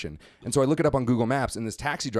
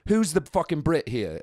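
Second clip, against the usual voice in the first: put-on British accent; 4 semitones higher, slower than usual. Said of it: "another British kid came up and he was like".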